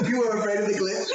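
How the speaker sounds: high squealing mock question through laughter; giggling high-pitched disbelief; laughing tease. Real, not acted. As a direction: crack up while teasing him. Mocking friend's fear, laughing.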